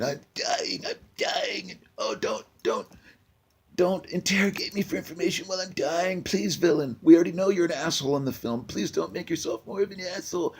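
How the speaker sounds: high, whimpering plea of a dying man; hammed-up dying victim begging the villain; pitiful dying Russian. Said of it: "the Russian would seem vulnerable".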